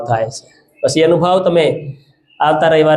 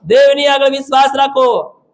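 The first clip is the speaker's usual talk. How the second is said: high, ringing exhortation; preacher driving the command home; forceful preaching; 10 semitones higher than usual. Real, not acted. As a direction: urge the congregation at a high pitch.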